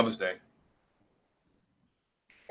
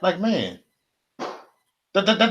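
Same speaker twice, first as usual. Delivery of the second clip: normal talking voice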